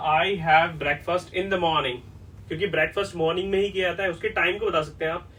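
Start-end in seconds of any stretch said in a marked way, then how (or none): 0.1-2.0 slow example. Shown as a demonstration. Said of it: "ये बोलना गलत है कि".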